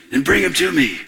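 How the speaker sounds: hammed-up distressed plea. Preacher as troubled Saul calling for a musician, distressed. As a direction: play the troubled king giving orders. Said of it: "he's just all distressed".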